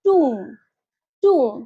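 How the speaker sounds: "টুম" with silent B, toom; correct pronunciation. Shown as a demonstration. Teacher modelling for learners, "টুম" pronounced toom. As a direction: end "টুম" on M, no B. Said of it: "সঠিক উচ্চারণ হবে".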